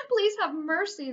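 high, loud, slow begging; desperate boys crying; pleading cry. A narrator's reading. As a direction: plead for mercy. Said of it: "the boys cried".

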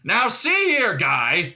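high, loud cop hailing; bullhorn voice. A narrator's reading. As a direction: lay down the law as if by bullhorn. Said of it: "and then the bullhorn again".

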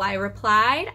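ordinary delivery

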